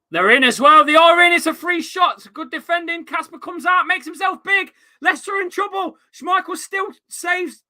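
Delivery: loud, high, breathless play-by-play; excited live commentary. Real, not acted. Streamer calling a scramble in the box to chat, high and shouting. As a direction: call the danger like a commentator on the edge of his seat.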